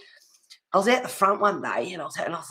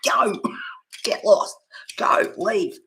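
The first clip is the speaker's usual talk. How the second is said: shooing someone off in a forceful whisper; urging away; 4 semitones higher, slower than usual. Acted. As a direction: whisper it forcefully in short bursts. Wishing the neighbour away.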